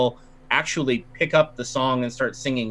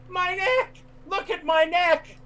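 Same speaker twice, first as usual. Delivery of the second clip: much higher, faked distress wail; hammed-up panic. Woman faking a vampire bite to the crowd, wailing high. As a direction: cry out about the neck in a put-on high voice. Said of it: "she's like".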